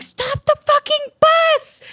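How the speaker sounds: high, loud, slow yelled order; reenacted shout. Ordering the bus driver to stop, a loud high yell. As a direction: scream it at the driver like you mean it.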